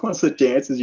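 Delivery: imitated amused laughing. Mate mocking the breakdown news, lightly laughing. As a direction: quote him through a chuckle. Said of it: "he was laughing his head off".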